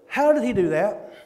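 ordinary delivery

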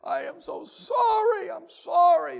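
high, loud mock sobbing apology; weeping prisoner. Preacher voicing the weeping prisoner, high sobbing. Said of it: "he was weeping and crying".

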